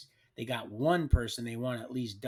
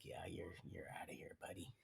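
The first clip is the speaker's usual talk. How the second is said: whispered, much softer; whispered dismissal; 6 semitones lower, 14 dB quieter than usual. Retold or imitated. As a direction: hiss it low like a smug guard. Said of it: "He's like".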